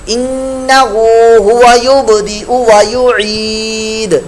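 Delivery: slow measured tajweed recitation; slow Arabic Quran recitation. Shown as a demonstration. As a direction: chant each word slowly and clearly. Teacher recites the verse.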